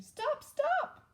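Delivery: very high, loud shout; the prince cries out to stop the king; very high, loud cry. Acted. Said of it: "shouted the prince".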